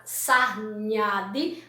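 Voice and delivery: SARNHADI slowly sounded out; syllable by syllable. Shown as a demonstration. Teacher finding the made-up word hard to pronounce, slow syllables. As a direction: labour through sarnhadi one syllable at a time. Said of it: "Até difícil da gente, é, pronunciar".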